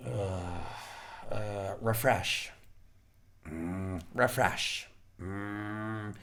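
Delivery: very low, soft, slow muttering; dazed doom-scrolling. Acted. Acting out four days of doom scrolling, dazed low muttering.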